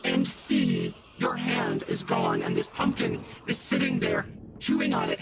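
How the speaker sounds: creepy deep pumpkin growl; pumpkin head. Acted. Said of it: "I've been this pumpkin voice the whole time".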